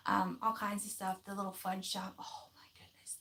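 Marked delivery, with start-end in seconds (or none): 2.2-3.2 hushed whisper about a treat. Real, not acted. Telling viewers about the little fudge shop in a hushed whisper. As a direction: whisper it like a delicious secret.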